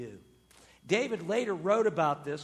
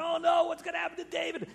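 mock alarm; 9 semitones higher, 3 dB louder, faster than usual. Preacher voices suspense of scene.

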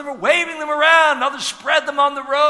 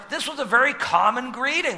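ordinary talk, nothing marked

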